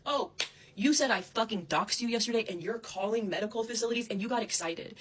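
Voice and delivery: loud furious accusation; angry yelling. Real, not acted. Yelling at Krissa over the medical facility call, furious. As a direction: yell it at her, furious. Angry rant at former friend.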